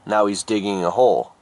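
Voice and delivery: plain reading voice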